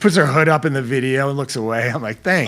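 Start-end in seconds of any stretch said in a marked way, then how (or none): 2.2-2.5 high, loud mock gratitude; sarcastic thanks. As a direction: play the snubbed dad's ironic thanks. Mock reply to unimpressed daughter.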